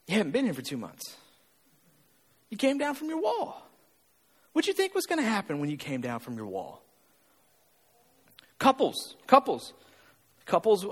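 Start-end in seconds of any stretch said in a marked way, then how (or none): 0.1-6.8 trainer's dry reply. Retold or imitated. Trainer answering a gym-goer gone two months, soft and dry. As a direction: answer the griper quietly and matter-of-factly. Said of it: "I'll go".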